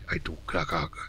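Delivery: hushed whisper. Real, not acted. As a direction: breathe it under the voice. Whispered aside in dark corridor.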